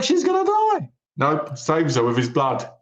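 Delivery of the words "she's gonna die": very high-pitched mock panic; hammed-up dread, voice leaping way up; mock alarm. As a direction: squeal it like an alarmed viewer. Acts the viewer's alarmed reaction.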